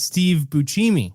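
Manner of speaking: BUSCEMI as boo-CHEE-mee; Italianised BUSCEMI with a ch sound; insisted correction. Shown as a demonstration. Host correcting co-host, Italian-style boo-CHEE-mee for BUSCEMI. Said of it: "You're wrong".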